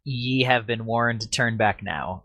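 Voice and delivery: usual speaking voice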